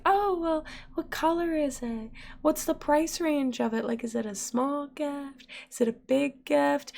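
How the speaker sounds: high, soft, coy questioning; playful sing-song wheedling, imitated; cutesy guessing. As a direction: fish for hints in a high little voice. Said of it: "we were trying to be cute".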